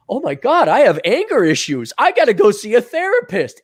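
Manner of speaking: hammed-up worried young man; naive alarm. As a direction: blurt out exaggerated self-doubt. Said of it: "if I was any younger or less experienced".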